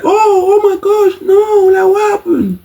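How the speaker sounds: very high falsetto mock dismay; squeaky, hammed-up horror; mock shocked nurse. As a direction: imitate a nurse wailing in fake shock. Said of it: "the nurse comes back now and is like".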